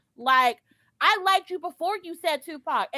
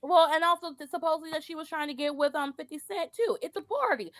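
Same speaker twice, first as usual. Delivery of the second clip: ordinary talk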